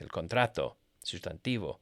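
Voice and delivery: ordinary talk, nothing marked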